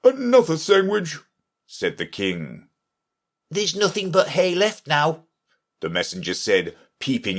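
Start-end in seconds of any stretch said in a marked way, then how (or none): 0.0-1.2 king voice. A narrator's reading. 3.5-5.3 messenger voice. A narrator's reading.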